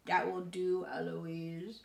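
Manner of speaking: parroting in a put-on voice; mock the tutor by echoing him; child mimicking tutor. A narrator's reading. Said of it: "And then I say".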